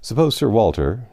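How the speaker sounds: ordinary delivery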